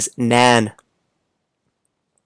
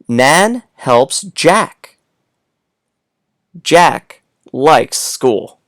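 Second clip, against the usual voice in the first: slow reading aloud; much slower than usual. Shown as a demonstration. Teacher reading to kids.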